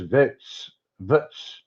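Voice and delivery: "votes" squashed into a short "Vits"; mocking mispronunciation. Commentator mocking Trump's word for votes, clipped "Vits". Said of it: "Doesn't say votes like everyone else".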